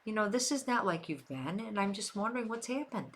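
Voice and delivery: husband gently asking his wife what's wrong, soft and caring; gentle honey tone. Retold or imitated. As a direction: coax her gently like a loving spouse. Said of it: "it's like".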